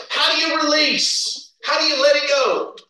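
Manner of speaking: insistent rhetorical questioning; emphatic preaching. Real, not acted. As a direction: press the congregation for an answer. Preacher puts questions to congregation.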